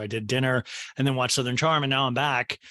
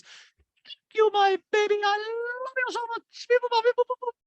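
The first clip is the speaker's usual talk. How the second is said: put-on Dorit's baby talk. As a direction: coo it to a toddler. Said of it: "Drake goes".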